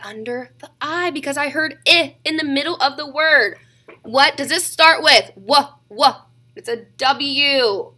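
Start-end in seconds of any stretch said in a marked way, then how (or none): none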